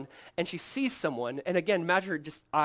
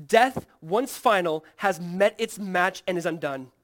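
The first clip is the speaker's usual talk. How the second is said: slow, louder, each word weighed; slow proclamation; 3 semitones higher, 9 dB louder, slower than usual. Acted. Triumphant sermon climax.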